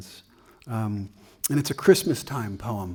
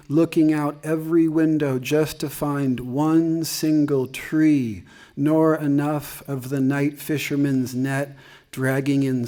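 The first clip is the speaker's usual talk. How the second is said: poem read aloud, raised pitch and volume over chat; poetry reading; 3 semitones higher, 5 dB louder than usual. Acted. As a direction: recite lines with lingering phrases. Reads own poem to audience.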